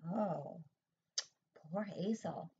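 ordinary delivery

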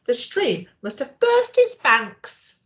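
lightly voiced fretful father mouse; a hint of an alarmed dad; dad's voice. Dad voiced in read story.

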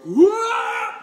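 high playful exclamation; playful wah. Acted. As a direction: pop out a high wah for the child. Playful shout in song.

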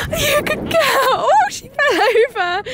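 high cheering voice; high delighted praise; excited praise. Real, not acted. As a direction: cheer the dog on. Cheering dog on course.

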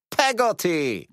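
"Peggotty" called out high and loud; calling out. A narrator's reading. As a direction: call "Peggotty" loudly through the door. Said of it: "zawołała panna Betsy".

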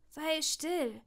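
ordinary delivery

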